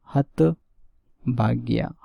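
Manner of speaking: हतभाग्या said very slowly, each syllable clear; slow correct form. Shown as a demonstration. Teacher dictates the correct word slowly to students. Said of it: "हतभाग्या शब्द जो है वो सही बनेगा".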